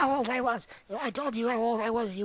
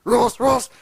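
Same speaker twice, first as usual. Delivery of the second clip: loud repeated creature call; booming creature cry; louder monster roar; 3 semitones higher, 13 dB louder than usual. Acted. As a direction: roar it closer and closer.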